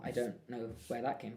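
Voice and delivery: ordinary delivery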